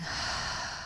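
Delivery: audible breath let out through the mouth; long sighing exhale. Shown as a demonstration. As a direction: sigh out to release everything.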